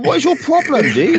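high, disbelieving challenge; baffled at the stepdad; exasperated. Retold or imitated. Viewer shouting at the stepdad, high exasperated challenge. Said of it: "I'm like".

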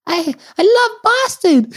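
put-on squeaky high child voice, drawn out; starstruck little kid. Ten-year-old to Larry Bird, high excited squeak. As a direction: imitate a tiny fan blurting it out. Small child speaking to idol.

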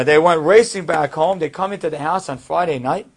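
ordinary delivery

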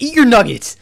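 loud, high mock-aggressive yell; aggressive shout. Ken Jeong's character scolds children over uneaten food, aggressive shout. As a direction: yell it like an exasperated dad. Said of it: "really aggressive".

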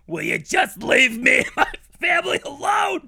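high, loud pleading lawyer voice; mock lawyer shout. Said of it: "That's what the lawyer says".

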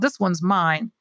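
usual speaking voice

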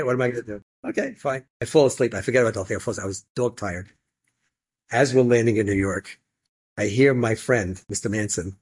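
normal talking voice

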